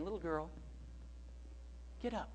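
put-on soft, tender call to a child; quiet and gentle. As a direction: gently coax her in a hushed voice. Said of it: "he said it gently".

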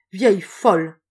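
loud yell of rage; howled insult. A narrator's reading. Old woman gripping the window bar, howling at rival. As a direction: hurl it out as a furious howl. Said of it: "hurla-t-elle en se redressant".

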